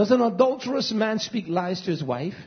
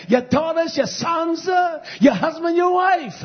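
loud, high, pounding sermon delivery; fiery preaching; 6 semitones higher, 4 dB louder than usual. Acted. Preacher hurling accusations at his congregation, thundering. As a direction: hammer each phrase at the flock.

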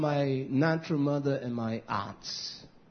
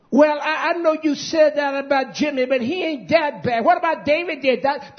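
mocking relative; 7 semitones higher, 5 dB louder than usual. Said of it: "That's how stupid they are".

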